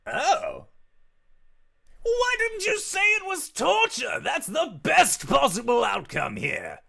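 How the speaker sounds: high, loud delighted shout; thrilled at the word torture; gleeful demon. Acted.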